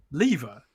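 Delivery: "lever" slowly, as LEE-vuh; British pronunciation. Shown as a demonstration. British co-host explains the spelling rule, slow British pronunciation. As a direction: draw out the long e in "lever". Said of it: "makes the preceding E sound like a capital".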